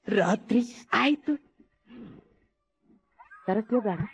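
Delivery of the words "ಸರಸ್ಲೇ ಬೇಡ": nervous groom. Acted. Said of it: "ಯಾಕ ನಂದು ಕೈಕಾಲು ನಡಗಕತ್ತ".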